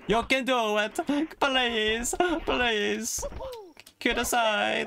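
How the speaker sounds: high put-on begging; playful pleading. Gamer urges game character up, playfully pleading. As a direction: beg the character along in a high voice.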